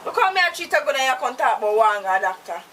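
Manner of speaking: loud, heated scolding rant; angry tirade. Acted. Woman raging at the captive who mocks her, loud. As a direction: tear into him at full volume.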